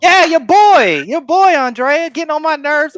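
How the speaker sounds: fed-up outburst at full volume; exasperated. Real, not acted. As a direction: complain loudly, stretching out the words. Venting about annoying villain.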